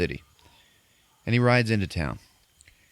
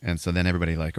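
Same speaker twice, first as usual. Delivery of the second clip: ordinary talk, nothing marked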